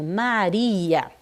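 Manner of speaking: "Maria" com R de um toque leve; R fraquinho. Shown as a demonstration. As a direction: alongue "Maria" com o R bem suave. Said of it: "é um R fraquinho". (erre fraquinho em "Maria")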